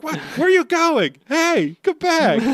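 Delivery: high, loud slow calling after; confused Luffy. Retold or imitated. Voicing Luffy as crew runs off. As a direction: wail after them. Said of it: "He's like".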